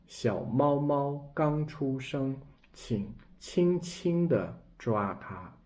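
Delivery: slow, tender example sentence read to learners. Shown as a demonstration. Teacher reading an example sentence aloud, slow and gentle. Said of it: "We see this example".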